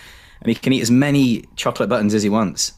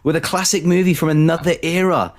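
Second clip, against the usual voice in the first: higher-pitched, drawn-out show opening; podcast intro; 7 semitones higher, slower than usual. Acted. Host welcoming listeners to episode nine, announcer style.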